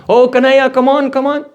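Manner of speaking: high-pitched call to come home; calling a child. Retold or imitated. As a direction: beckon a child in from play. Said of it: "go and call my son to come back".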